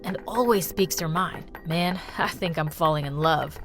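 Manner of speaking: put-on teen boy voice.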